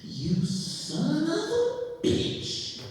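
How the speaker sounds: very slow curse rising to a high pitch; mock outrage. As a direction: stretch it out, climbing into a squeal. Imitates the boy's outraged curse.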